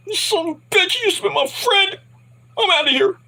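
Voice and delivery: high, strained indignant cry; betrayed mock outburst. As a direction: imitate a hurt friend storming off. Acting out betrayed man storming off.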